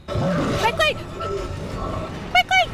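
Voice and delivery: urgent yell. Real, not acted. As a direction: hurry yourself up in panic. Urging self while fleeing.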